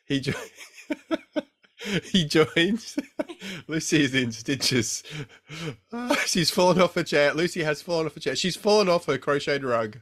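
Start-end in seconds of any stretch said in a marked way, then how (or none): 1.2-2.9 words through laughter; cracking up mid-word; laughing. Real, not acted. Breaking up laughing. 2.9-3.6 helpless laugh; laughing hard. Real, not acted. 3.7-10.0 giggly, breathless from laughing; talking through leftover laughs; after laughing hard. Real, not acted. Still laughing after fit.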